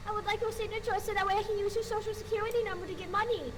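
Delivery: high, silly scammer voice; squeaky mock voice. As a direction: squeak it out sweetly. Mocking high voice to ghost.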